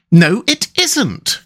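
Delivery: high, drawn-out indignant denial; storybook rat in a huff. A narrator's reading. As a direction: contradict crossly, pitched right up and stretched. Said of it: "cried the rat indignantly".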